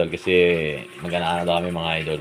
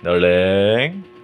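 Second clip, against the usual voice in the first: usual speaking voice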